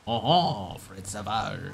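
hammed-up French sigh of recognition; mock French accent. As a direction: murmur the name in pretend French. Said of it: "as Fred Savage".

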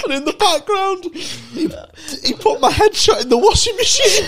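high, loud punchline through laughter; laughing hysterically. Real, not acted. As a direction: shout it while cracking up.

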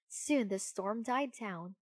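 ordinary delivery

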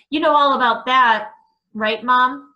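loud, accusing question; angry outburst. Acted. Son confronts mother angrily.

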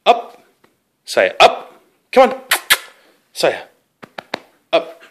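high, loud excited command; dog command. Real, not acted. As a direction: call the dog up eagerly.

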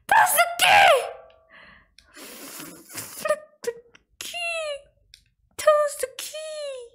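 high-pitched frustrated whine; upset. Real, not acted. Gamer upset over a key, high whining demand. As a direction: squeal it in exasperation, stretching KEY. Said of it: "getting upset over a key".

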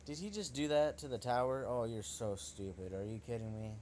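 ordinary talk

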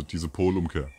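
normal talking voice

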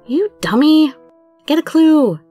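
high-pitched exasperated outburst; exasperated scolding. Acted. Heroine scolds Subaru in acted quarrel.

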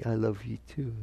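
soft, sincere answer; expected loving reply. Acted. Partner answers an intimate declaration of love, softly sincere. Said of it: "They're supposed to say".

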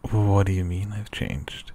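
ordinary delivery, nothing marked

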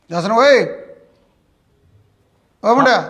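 loud, high call for the son. Acted. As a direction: shout across the house. Father calls his son. (ਪਿਓ ਦਾ ਮੁੰਡੇ ਨੂੰ ਉੱਚੀ ਆਵਾਜ਼ ਮਾਰਨਾ)

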